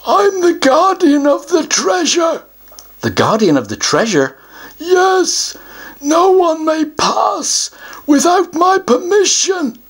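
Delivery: a self-important troll puffing himself up; troll guardian. Acted.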